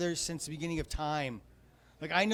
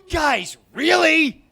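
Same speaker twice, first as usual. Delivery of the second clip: slow, loud, drawn-out disbelief; exasperated plea; 10 semitones higher, 10 dB louder, much slower than usual. Acted. Comic exasperated at the state, slow shout. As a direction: shout it like you can't believe them.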